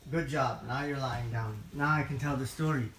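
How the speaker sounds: ordinary delivery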